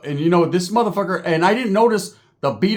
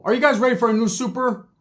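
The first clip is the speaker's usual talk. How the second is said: ordinary talk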